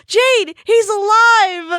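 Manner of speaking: high, loud, drawn-out exclamation; mock excited shout. Breaking news to Jade, hammed-up excited shout. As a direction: shout it high and stretch the last word.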